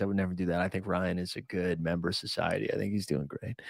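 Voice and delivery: walk it back as if caught; mock innocent backpedal. Taking back the joke threat for the camera, sheepish and soft. Obviously, I'm joking.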